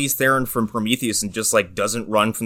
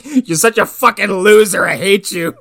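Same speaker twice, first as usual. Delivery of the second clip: higher, mock-angry rant; mock outburst; 7 semitones higher, 3 dB louder than usual. Father berating a kid after a wreck, mock anger. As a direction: lash out in hammed-up contempt. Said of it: "and he's like".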